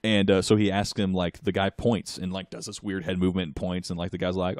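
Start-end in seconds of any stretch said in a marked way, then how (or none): none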